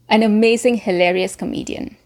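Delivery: normal talking voice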